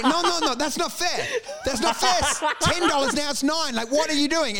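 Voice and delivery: high-pitched imitation of an outraged vendor; mock indignant protest. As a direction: protest as if being cheated, hammed up. Acting out vendors protesting haggling.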